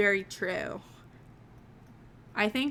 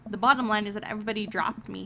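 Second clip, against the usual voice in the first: ordinary talk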